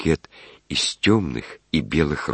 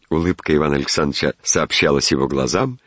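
usual speaking voice

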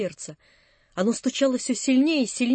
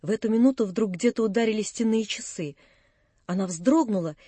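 usual speaking voice

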